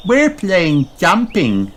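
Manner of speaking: slow high piglet voice; proudly explaining the game; Peppa. A narrator's reading. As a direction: Peppa telling Rebecca. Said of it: "says Peppa".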